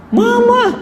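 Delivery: high, sharp call of the wife's name; mock angry yell. Husband tasting salty noodles, mock angry high yell.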